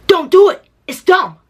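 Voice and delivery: high, loud mock scolding; imitated telling-off. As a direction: imitate him sternly getting on you. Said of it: "he's getting on me".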